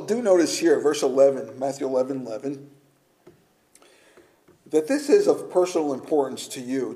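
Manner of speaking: ordinary delivery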